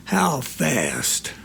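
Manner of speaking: a hint of a slow, cool drawl; mild drawl. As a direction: lean in and drawl it low. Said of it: "he drawls".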